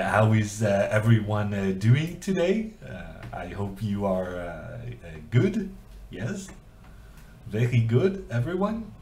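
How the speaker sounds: halting French accent with rising questions; put-on French accent. Shown as a demonstration. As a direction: greet the crowd in a generic French accent. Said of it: "you're ready with the accent".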